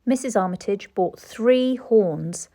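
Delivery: ordinary delivery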